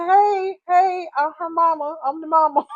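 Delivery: high, loud excited call. Retold or imitated. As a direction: shout down to get her attention. Calling out to [name].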